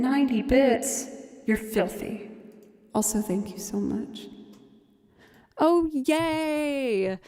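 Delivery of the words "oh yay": normal talking voice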